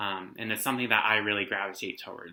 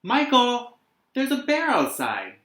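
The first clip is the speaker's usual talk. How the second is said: put-on high, loud urgent call of a young boy; excited boy; 12 semitones higher, 7 dB louder than usual. Little boy Carl calling to his brother, excited and high. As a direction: call out the news breathlessly high. Child calling about a bear.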